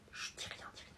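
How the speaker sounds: urgent whispered hush; quick, keep it under your breath. Acted. Kid warns his friend not to tell dad, whispering.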